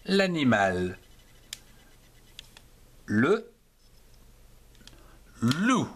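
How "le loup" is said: "Le", long pause, then "loup"; word-by-word model. Shown as a demonstration. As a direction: set "loup" apart as one syllable. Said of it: "Une seule syllabe".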